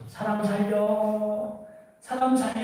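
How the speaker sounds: mock cry of help from the cliff. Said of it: "소리를 지르죠".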